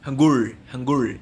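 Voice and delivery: "Hangul" said slowly, twice, as in Korean; slow, careful "Hangul, Hangul"; Korean pronunciation. Shown as a demonstration. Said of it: "É o Hangul".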